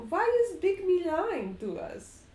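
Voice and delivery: put-on high child voice; a boy puzzled and a little upset; little Strahd. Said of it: "little Strahd looks at you and says".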